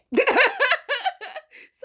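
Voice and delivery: bright burst of laughter; high laugh. Real, not acted. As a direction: laugh out loud. Laughs after quote.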